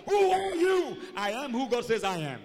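high, shouted declaration, stretched out; preaching at full force. Real, not acted. Preacher declaring God's words over the congregation, shouting triumphantly. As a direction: shout each promise to the rafters.

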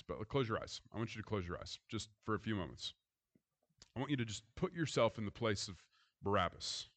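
soft, hushed voice leading a visualization; guided visualization. Acted. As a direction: ask them gently to close their eyes and imagine. Leads listeners into imagining.

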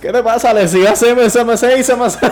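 loud, high-pitched mock glossolalia; hammed-up Pentecostal frenzy of syllables; speaking in tongues. Joking about a pentecostal syndrome, frenzied mock tongues. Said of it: "Porque ellos hablan en lengua".

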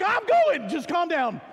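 breathless irritated reassurance; winded bravado. Retold or imitated. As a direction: bark it through gasps at the people below. And now I can't breathe.

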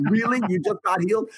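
can't believe it; shocked. Retold or imitated. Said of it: "more shocked than she was".

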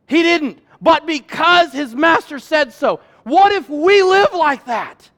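loud, high, slowed, building sermon climax; rally the room like a revival preacher; full-force preaching. Acted. Preacher urging the congregation to obey God, at full force.

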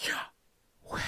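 slow voiceless breath, "yah", pause, "weh"; whispered inhale, then exhale; breathed name. Shown as a demonstration. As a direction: let the name be only breathing. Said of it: "but a breath?".

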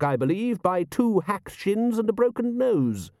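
high, drawn-out, measured patrician. A narrator's reading. Patrician reporting the football score, measured and high. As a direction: savour each phrase as a composed ruler.

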